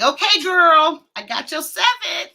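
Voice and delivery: put-on high, excited brag to a girlfriend; playful boast. Streamer rehearsing for next time she sees her friend, playful boast. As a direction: crow it playfully, pitched well up. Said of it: "I'm gonna be like".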